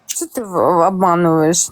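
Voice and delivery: ordinary talk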